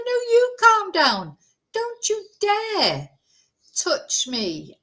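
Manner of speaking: high, slow, indignant snap back; mock angry retort. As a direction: throw her words back at her, slowly. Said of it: "I started going off on one in Spanish".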